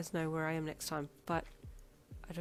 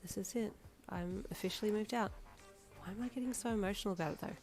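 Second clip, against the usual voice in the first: quiet thought; 3 semitones higher, 7 dB quieter than usual. A narrator's reading. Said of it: "you thought".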